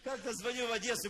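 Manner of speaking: ordinary talk, nothing marked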